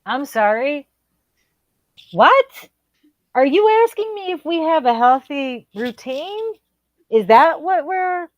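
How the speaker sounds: high, loud stunned squeak; shocked disbelief. Retold or imitated. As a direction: sound like you misheard something outrageous. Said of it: "And I was like".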